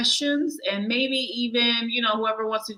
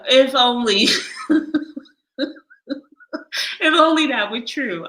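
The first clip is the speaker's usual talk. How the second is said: laughing; 5 semitones higher, 6 dB louder than usual. Real, not acted. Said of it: "why I'm laughing".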